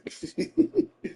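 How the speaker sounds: high, soft chuckle; quiet high giggle to oneself; real laugh. Real, not acted. Recalling her boo-hooing over the wig, soft high laugh.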